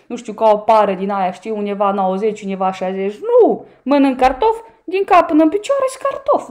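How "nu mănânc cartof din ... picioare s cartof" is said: higher and louder, a burst of self-mocking glee; autoironie veselă. Real, not acted. Glumă despre propria greutate. (exclamație autoironică, mai sus și mai tare)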